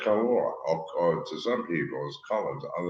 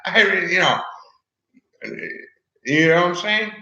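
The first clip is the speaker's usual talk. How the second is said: screaming, much higher and louder, slowing on pissed off; furious yelling; 13 semitones higher, 14 dB louder than usual. Real, not acted. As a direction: lose it completely and yell.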